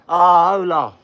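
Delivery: loud, drawn-out cheer; delighted call. Real, not acted. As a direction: cry it out loudly to a supporter. Calling out in delight to donor.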